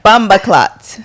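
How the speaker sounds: jokey Jamaican patois imitation; mock Jamaican accent. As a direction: throw it out in a hammed-up Jamaican accent. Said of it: "Can you do a Jamaican accent?".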